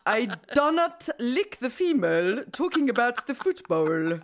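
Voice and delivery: hammed-up comedy French accent; mock-French delivery, vowels all twisted; cod French accent. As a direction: mangle the English like a sitcom Frenchman. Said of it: "in a cod French accent".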